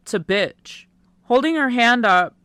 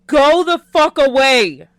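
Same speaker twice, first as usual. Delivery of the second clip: loud, higher-pitched outburst; angry yell; 4 semitones higher, 8 dB louder than usual. Acted. Heartbroken man sends his friend away, angry yell. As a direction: yell it to drive someone off. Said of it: "I yell out".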